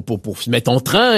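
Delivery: normal talking voice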